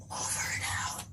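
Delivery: mock-secret whisper; whispered sign-off. Signing off the walkie-talkie in a playful whisper. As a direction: breathe it like a covert radio operator.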